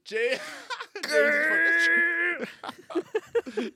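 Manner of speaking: words squeezed out between laughs; cracking up at the glowing co-host; laughing hard. Real, not acted. Laughing at the co-host's glow.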